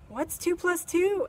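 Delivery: slow, high, loud test question; testing the robot; boy quizzing. Acted. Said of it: "asked Matt".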